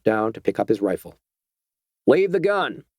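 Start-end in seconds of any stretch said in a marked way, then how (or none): 2.1-2.8 curt order. A narrator's reading. Harry orders the doctor to leave the rifle, sharply. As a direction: stop him cold.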